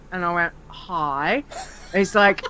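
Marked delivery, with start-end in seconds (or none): 0.7-1.4 lower, softer deadpan "Hi."; wary reply. Retold or imitated. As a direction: answer the stranger low and unimpressed. Narrator answers flatly.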